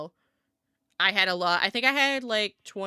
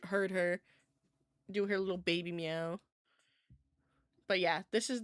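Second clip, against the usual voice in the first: usual speaking voice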